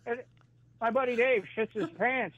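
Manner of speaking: usual speaking voice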